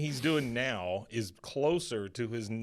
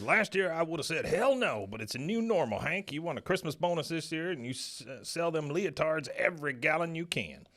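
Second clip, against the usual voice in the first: quoting Buck; 6 semitones higher than usual. Retold or imitated. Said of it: "So Buck, he says".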